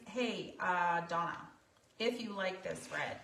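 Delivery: ordinary talk, nothing marked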